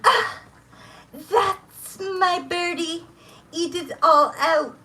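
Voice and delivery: high, slow, cooing woman's voice; imitating a woman. Retelling a recording, voicing the woman's coo. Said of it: "You can hear a woman go".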